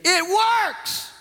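very high-pitched yell down the phone; high, sudden mock shout; exact imitated outburst. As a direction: mimic a woman shrieking her news to a caller. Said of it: "She said again".

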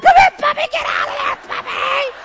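loud, extremely high falsetto; shriek-call the dog back in falsetto; frantic puppy call. Retold or imitated. Owner calls puppy from under the stall, frantic falsetto.

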